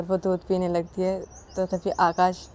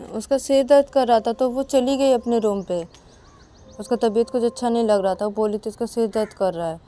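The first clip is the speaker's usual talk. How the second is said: voiced husband; 4 semitones higher, 7 dB louder than usual. Akash explains Ananya left.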